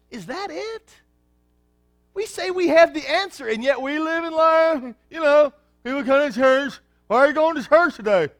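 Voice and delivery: high, loud fervent preaching voice; full-force preaching. Acted. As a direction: preach it out to the congregation, rising hard.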